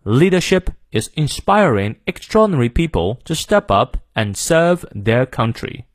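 British English demo reading, slowing down at the end; British accent. Shown as a demonstration. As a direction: model the sentence in British English, non-rhotic. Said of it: "再来一遍". (英式发音领读)

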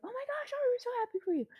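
very high, sweet cooing congratulations; a high mock chorus of joy; squeaky happy friends. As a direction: coo it like giddy friends. Friends voiced congratulating her.

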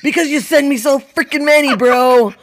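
high, loud mock outburst; exasperated retort. Wife snaps back at spouse over too many reels, loud exasperation. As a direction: protest loudly, pushed up in pitch.

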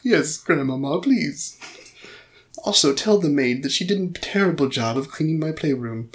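badly faked British accent, imperious child; Little Lord Fauntleroy. Spoilt boy complaining about the maid, mock British accent. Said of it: "I don't think my British accent".

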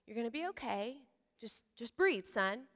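soft, hushed, steady reassurance; calm dad. Retold or imitated. Father calming his choking son, soft and calm. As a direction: settle the choking kid gently. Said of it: "Dusty's a very calm parent".

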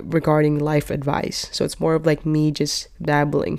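normal talking voice

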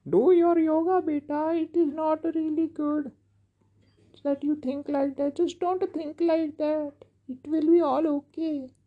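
high, loud patronizing counselor; mocking counselor voice. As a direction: parody a preachy counselor's advice. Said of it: "the fucking counselor just fucking says".

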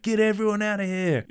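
high-pitched imitation of a yell; urgent warning. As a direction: call out a mock evacuation order. Retold hero's urgent warning.

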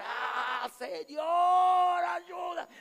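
high, drawn-out plea; crying out. Retold or imitated. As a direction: wail loudly for help. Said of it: "Y vuelve a clamar".

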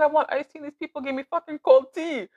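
high-pitched indignant retelling; mock outrage. Retelling being served cold tea to co-host, hammed-up outrage. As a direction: crank it up in incredulous protest.

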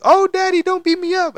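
very high mock little kid voice; imitating his sons begging daddy Hulk; kids' voices. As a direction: squeak it like a scared toddler. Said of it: "they're like".

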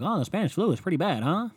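lightly voiced flippant kids; imitating classmates shrugging off plagues; mock schoolkid. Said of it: "we were like".

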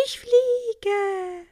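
high, loud cry of delight; little bee crying out on her first flight; joyful cry. A narrator's reading. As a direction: shout it out in pure joy. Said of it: "rief sie".

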